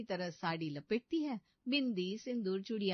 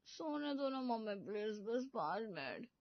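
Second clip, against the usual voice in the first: sleepy; 7 dB quieter than usual. Acted. Sleepy bride begging more sleep.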